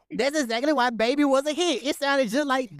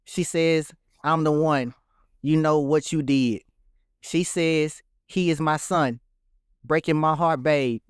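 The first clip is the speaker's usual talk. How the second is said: flat reading of lyrics; 7 semitones lower, slower than usual. Pretend. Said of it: "This man".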